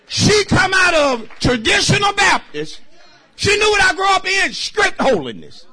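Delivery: high, loud shouting testimony; fiery sermon shout; preaching at full force. Real, not acted. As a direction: whoop it up for the congregation.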